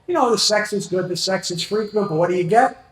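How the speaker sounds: usual speaking voice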